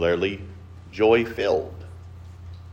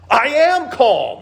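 much higher, louder, slow insistence; irritated retort; 4 dB louder, slower than usual. Retold or imitated. Upset friend snapping back at being told calm down, mock irritated. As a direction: bite out each word, clearly not calm.

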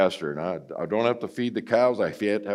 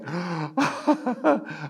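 high delighted gasp with a laugh; hammed-up gasp; mock ecstatic gasp; 10 semitones higher than usual. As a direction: gasp as if struck by joy. Mock instant healing.

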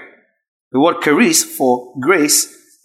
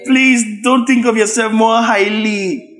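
mock gushing; 5 semitones higher than usual.

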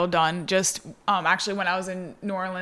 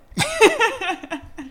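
high loud burst of laughter; big laugh; 5 dB louder than usual. Real, not acted. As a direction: crack up. Laughs after quote.

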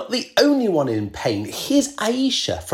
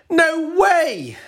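no way; 12 semitones higher, 3 dB louder than usual. Real, not acted. Rejecting idea of changing score.

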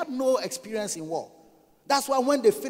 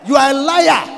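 shouted accusation; 3 semitones higher, 10 dB louder than usual. Real, not acted. Preacher calling out the false flatterer, shouted accusation. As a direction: hurl the charge at full volume.

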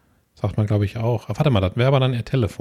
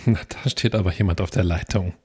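bitchy; 4 semitones lower, 3 dB quieter than usual. Retold or imitated. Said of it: "ein bisschen bitchy".

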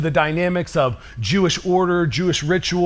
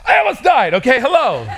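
high, pitched-up protest of near death; indignant outburst; 7 semitones higher than usual. Retold or imitated. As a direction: insist you nearly died, voice jumping up. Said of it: "I'm like".

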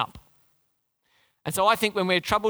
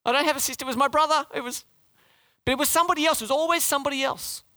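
put-on higher-pitched kid making excuses; blame-shifting child; 8 semitones higher, faster than usual. Preacher imitates kids blaming a sibling, higher child voice. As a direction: plead like a kid passing the blame. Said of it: "Kids do it all the time".

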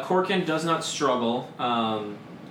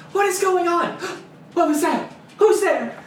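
much higher, louder startled guard; 7 dB louder than usual. Acted. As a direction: jump at a noise and shout. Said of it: "the one is like".

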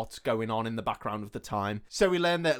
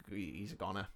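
mock grim verdict; 5 semitones lower, 14 dB quieter than usual. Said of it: "they're like".

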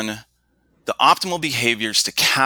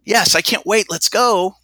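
high, sing-song mock enthusiasm; mismatched sarcastic tone; 10 semitones higher, 4 dB louder, slower than usual. Father imitating his daughter's reply to the picnic idea, mock enthusiasm. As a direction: overdo the cheer so it rings false. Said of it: "her vocal tone doesn't match the sentence".

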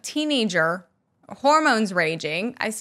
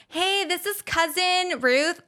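higher put-on caller voice; fake cousin voice; 8 semitones higher than usual. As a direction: pass yourself off as a cousin on the phone. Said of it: "pretend to be someone different".

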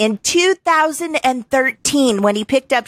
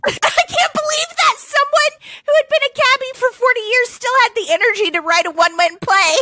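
high-pitched, cracking up in amazement; laughing disbelief; 13 semitones higher than usual. Real, not acted. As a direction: burst out of the reading, incredulous and laughing. Host breaks off reading, laughing in disbelief.

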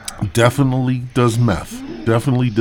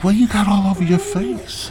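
woman's voice; 11 semitones higher, 3 dB louder than usual. Retold or imitated. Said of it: "she's like".

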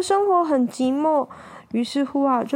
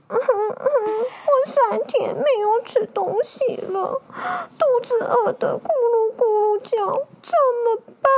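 whimpering hungry puppy; 9 semitones higher than usual. Acted. Stray dog frets alone about hunger, pitiful high whimper. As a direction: whine pitifully like a starving little dog. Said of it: "狗狗心里想着说".

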